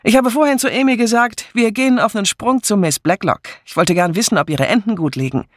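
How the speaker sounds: high, fast brisk voice; brisk countrywoman; brisk hearty woman. A narrator's reading. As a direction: rattle it off briskly. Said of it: "rief Miss Hinchliff".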